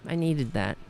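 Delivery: normal talking voice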